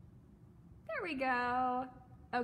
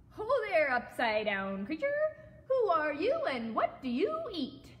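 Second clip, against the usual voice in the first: high, loud hail; shouted; 6 semitones higher, 7 dB louder than usual. A narrator's reading. Frog hails creature hanging upside down, shouted. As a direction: call out boldly as a brash frog. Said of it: "shouted the wide-mouthed frog".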